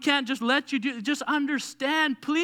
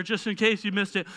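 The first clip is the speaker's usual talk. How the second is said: ordinary talk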